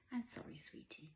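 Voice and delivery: hushed, tender mum voice; soft apology. A narrator's reading. Mother sorry to disappoint her daughter, soft apology. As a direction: apologise softly to a small child.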